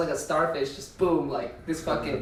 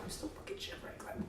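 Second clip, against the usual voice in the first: whispering; 22 dB quieter, faster than usual. Retold or imitated. Cold, quoting self under covers.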